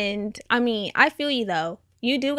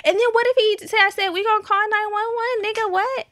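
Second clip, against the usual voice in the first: anxious friend; 10 semitones higher than usual. Retold or imitated. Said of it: "she was like".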